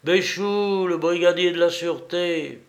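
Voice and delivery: insister, plus haut, un peu indigné; protestation. A narrator's reading. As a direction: protester qu'on le connaît, voix plus aiguë. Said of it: "La voix protesta". (Béchoux au téléphone proteste, voix plus haute)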